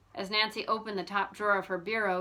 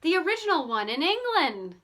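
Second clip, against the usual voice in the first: marveling; 7 semitones higher, 4 dB louder than usual. A narrator's reading. George marvels at picture.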